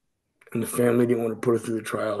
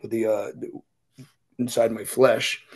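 usual speaking voice

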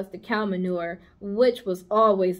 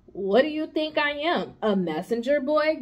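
grumbling rat; 5 semitones higher than usual. Acted. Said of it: "grumbled the rat".

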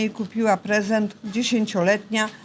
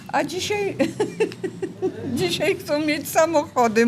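laughing; 8 semitones higher than usual. Real, not acted. Amused about today's youth.

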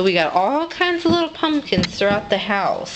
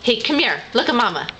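usual speaking voice

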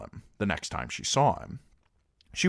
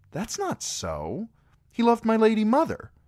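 indignant denial; 5 dB louder than usual. Acted. Arya rejecting the rumour about her father, rising to a loud outburst. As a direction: defend her father hotly.

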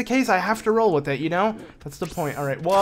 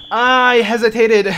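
loud, higher, drawn-out cry of dismay; frustrated outburst, stretched out; 7 semitones higher, 10 dB louder, slower than usual. Real, not acted. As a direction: cry out slowly at your own mistake. Botches a shot in the game.

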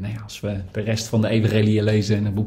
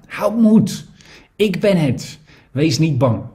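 lightly voiced Jesus, higher and calling over the storm; Jesus reassuring; 7 semitones higher, 3 dB louder, slower than usual. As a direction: reassure the frightened disciples. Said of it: "Meteen sprak Jezus hen aan". (lichte stem van Jezus, geruststellend)

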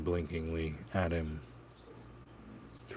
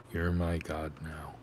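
defeated. Acted. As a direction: say it like he's given up. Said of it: "Ryan said, defeated".

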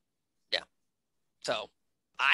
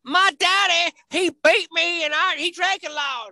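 high, loud, hammed-up sob story; mock tragic confession; 10 semitones higher, 5 dB louder than usual. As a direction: overplay her sad backstory, half mocking. Mocking wrestler's sob-story promo.

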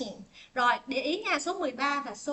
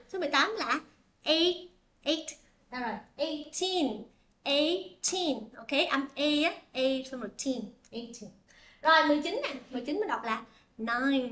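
usual speaking voice

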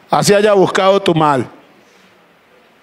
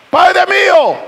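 high call, "Padre mío" carried far; calling from afar; 11 semitones higher than usual. Retold or imitated. David calling to King Saul from a distance, high-pitched. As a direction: call out as if across a field. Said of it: "Así le gritaba a lo lejos".

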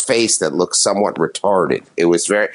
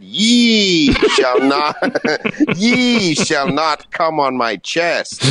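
put-on higher, very slow, solemn old-style voice; 9 semitones higher, much slower than usual. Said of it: "she said".

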